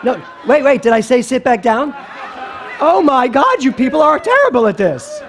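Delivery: loud, high playful scolding; mock indignation. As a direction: tease the crowd for botching the game. Teases audience failing the game.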